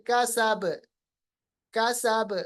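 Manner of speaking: कसब with a bounced final ब; qalqalah on ba. Shown as a demonstration. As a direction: stop on कसब, echoing the ब. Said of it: "आखिर में साकिन बनाए". (कसब के आख़िर में क़लक़ला)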